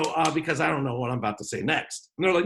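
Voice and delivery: usual speaking voice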